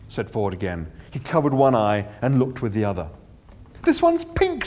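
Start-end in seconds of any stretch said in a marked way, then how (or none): 3.8-4.7 high, indignant; aggrieved surprise. Acted. Arthur indignant.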